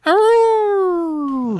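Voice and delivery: long, high, loud wolf howl. Pretend. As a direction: howl out one drawn-out arroo like a wolf. Howl after naming Cyril the Wolf.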